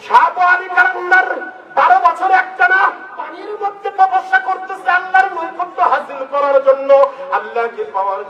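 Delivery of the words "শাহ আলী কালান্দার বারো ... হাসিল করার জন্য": fiery preaching. Acted. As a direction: call it out over the crowd. Preacher raises his voice.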